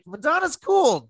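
high, slowed put-on voice; mock quoted voice. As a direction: say it as a high-pitched, teasing quote. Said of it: "My mom doesn't sound like that".

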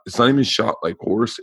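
ordinary talk, nothing marked

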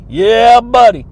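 loud, punchy yeah buddy; big hype shout to the listeners. Real, not acted. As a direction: belt it out like a hype man.

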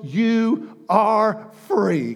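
You are free, stretched out very slowly; slow declaration. Acted. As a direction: lean on each word and hold it. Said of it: "drawn-out declaration".

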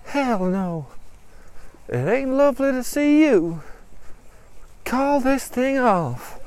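high-pitched, defiant character voice; defiant rejection. Acted. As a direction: refuse firmly, drawing out the end. Mary rejects her father.

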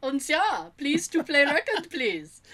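a light, hammed-up Russian accent on a caller's plea; mock Russian accent. Friend mocks the radio caller, in a light mock Russian accent. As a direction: play it as a bad Russian accent, pleading. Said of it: "Weren't you Russian five minutes ago?".

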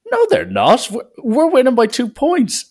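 high-pitched imitation of indignant players; squawked retold objection; mock protest. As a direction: pitch it way up and argue the score. Rival players dispute the score.

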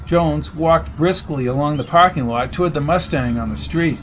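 plain reading voice

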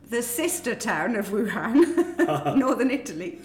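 laughing through the words; giggling while saying it. Real, not acted. Joking reveal through laughter.

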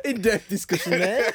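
sarcastic laughing tease. Real, not acted. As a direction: laugh through it mockingly. Mocking the short review.

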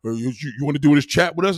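low, hurried dopey stammer; low stuttering put-on voice; mock chat inviter. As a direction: mimic a fumbling invite in a low, quick voice. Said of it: "They gotta".

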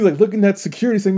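normal talking voice

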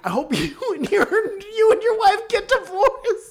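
high, loud shriek through laughter; hammed-up angry curse, cracking up; mock furious. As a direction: imitate a furious outburst at a squealing pitch. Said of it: "she got so mad that she was like".

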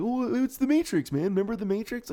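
high eager fanboy voice; excited fan voice. Retold or imitated. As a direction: gush about the old film. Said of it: "it was, you know, like".

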